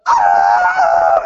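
loud, sustained imitation scream; acted-out scream of a woman on the floor. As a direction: let out a long, raw-throated wail.

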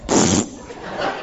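soft unvoiced "pfft" puff of breath; mock blowing puff. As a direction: blow out a quick puff of air. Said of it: "And I went, and I went".

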